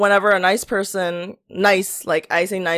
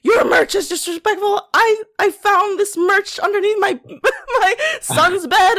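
high, shocked church-mom voice through laughter; mock outraged mom; 12 semitones higher than usual. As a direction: play the scandalised mother finding merch. Mocking outraged moms.

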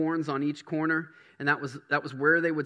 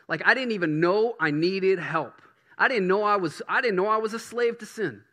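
rising preaching; 4 semitones higher, 5 dB louder than usual. Real, not acted. Sermon rising in intensity.

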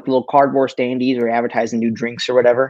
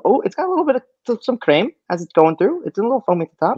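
delighted; 9 semitones higher, faster than usual. Real, not acted. Delighted noticing foam.

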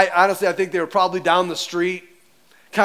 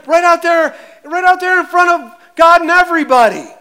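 louder and louder, pushing it out; building shout; 7 semitones higher, 7 dB louder than usual. Shown as a demonstration. Preacher to congregation, rising to a loud emphatic shout. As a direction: hammer it home to the congregation.

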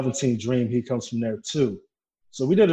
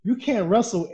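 higher, louder mock telling-off; mock scolding; 7 semitones higher, 4 dB louder than usual. Storyteller scolding the pouting wrestler, raised mock telling-off. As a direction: rub it in, mocking him.

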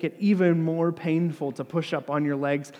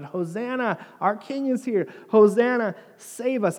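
higher-pitched mock cheering; retold crowd shout; 7 semitones higher, 4 dB louder than usual. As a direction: call out like a welcoming crowd, without a full yell. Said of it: "and shouted".

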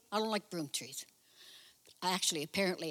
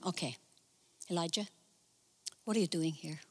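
softer, lower gentle asking; gentle still voice; 5 semitones lower, 5 dB quieter, faster than usual. Pretend. God gently asking Elijah again, soft and low. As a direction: ask tenderly, barely above a hush. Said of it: "the gentle voice was still going on".

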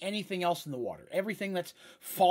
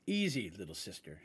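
low, soft calming giant; deep Minotaur voice; 6 semitones lower, 4 dB quieter than usual. Acted. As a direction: soothe her like a big brother. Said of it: "an incredibly deep voice".

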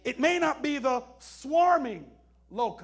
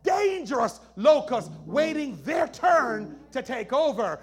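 loud, high fiery preaching; doomsday street preacher; 4 semitones higher, 10 dB louder than usual. Acted. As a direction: shout it like a revival preacher. Preaching at full force.

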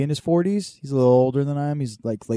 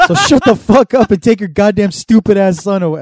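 yelling angry dad; 8 semitones higher, 9 dB louder than usual. Retold or imitated. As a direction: shout the neighbor down. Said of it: "it was just yelling back and forth".

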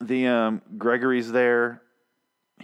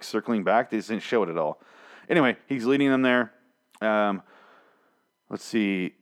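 usual speaking voice

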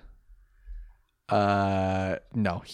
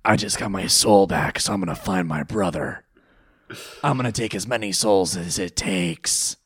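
lower, mock-intense anime rival voice; mock anime tough guy; Seto Kaiba voice; 3 semitones lower than usual. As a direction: do the brooding Kaiba voice, ending in a hushed whisper. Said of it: "You do the voice".

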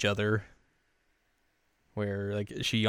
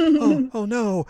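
high, loud worried cry; imitated girl's sudden alarm; mock dismay; 14 semitones higher, 8 dB louder, much slower than usual. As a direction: fret in a pitched-up voice. Mugi dismayed about warm hands.

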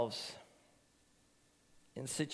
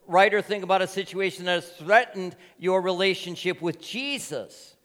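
high, loud pulpit preaching; a pastor urging the church not to lose Jesus, fiery and loud; 10 semitones higher, 9 dB louder than usual. Real, not acted. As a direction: preach it out to the congregation with full force. Preaching at full force.

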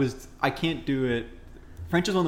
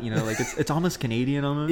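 usual speaking voice

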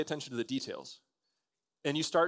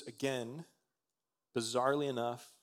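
normal talking voice

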